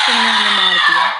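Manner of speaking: loud cry of defeat; loud shout. Real, not acted. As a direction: yell at being caught. Killed by ghost in game.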